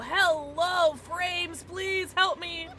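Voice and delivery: ordinary delivery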